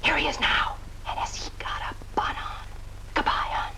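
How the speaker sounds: quick confidential whisper; hushed whisper. Acted. Ending a call to a friend as Johnny arrives, whispered. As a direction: whisper it so he won't hear.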